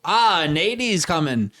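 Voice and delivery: slow, drawn-out mock Australian; Australian accent. Shown as a demonstration. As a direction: imitate a laid-back Aussie shortening words. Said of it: "I've noticed the Australians never say".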